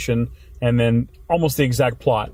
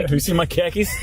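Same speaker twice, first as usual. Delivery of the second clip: put-on Boston accent; 4 semitones higher than usual. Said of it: "car keys with a Boston accent".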